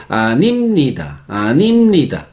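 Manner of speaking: imitating Spaniards, "animnida" with syllables split; Spanish-accented "animnida", swelling on the middle syllable. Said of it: "Los españoles se dice". (acento español imitado, sílabas separadas)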